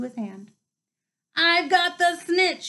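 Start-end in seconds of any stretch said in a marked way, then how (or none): 1.4-2.7 slow, high and louder, holding the win up; joyful slow cry of victory; triumphant shout. A narrator's reading. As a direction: shout it out, drawn out, to the whole crowd. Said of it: "he shouted".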